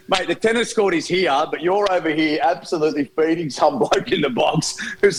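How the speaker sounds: mock tennis player rant. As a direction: gripe like a player at his team. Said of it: "And it's".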